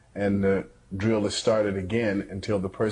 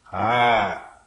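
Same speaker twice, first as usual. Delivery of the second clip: ordinary talk, nothing marked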